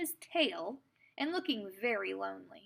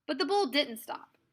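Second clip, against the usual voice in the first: plain reading voice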